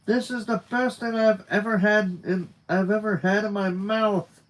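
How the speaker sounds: high, emphatic gushing over food; excited rave. Acted. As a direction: rave about it as the best ever. Enthusing over food in story dialogue.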